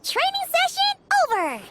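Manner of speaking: high, bright call; excited announcement. Acted. Paimon announcing the end of training, high and cheerful.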